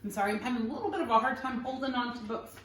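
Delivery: plain reading voice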